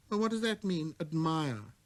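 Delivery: put-on a small boy musing to himself; little prince. Prince voiced.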